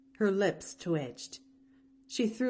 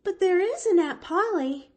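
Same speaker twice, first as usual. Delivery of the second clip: high, louder anxious plea; anxious demand; 12 semitones higher, 6 dB louder than usual. Acted. Orphan asking maid anxiously about her aunt. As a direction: press for the answer, worried. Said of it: "demanded the child anxiously".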